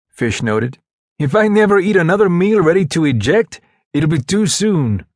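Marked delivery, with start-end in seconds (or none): 1.2-5.1 high, emphatic complaining buddy; griping soldier. Acted. Soldier fed up with field rations, high emphatic grumble. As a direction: gripe about the rations, drawing out the end.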